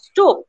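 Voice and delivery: "stop" said high and punched; stress on STOP. Shown as a demonstration. Teacher pointing out the stressed word, STOP punched high. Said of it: "I have used the intonation at".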